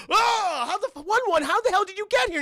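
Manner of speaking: high, loud startled outburst; mock shock. Group finds someone unexpected, high loud mock shock. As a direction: act out startled disbelief, loudly.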